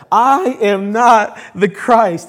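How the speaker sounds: loud, slow, ringing denial with "I" stretched; emphatic declaration. Retold or imitated. Preacher repeating John's denial of being Christ, loudly emphatic. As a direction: proclaim it, punching "I" and "not". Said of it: "he emphatically declares".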